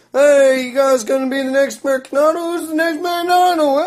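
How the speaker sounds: very high, fast, hyped yell; mock host yelling. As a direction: scream it like a cheesy game-show host. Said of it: "starts yelling at people like".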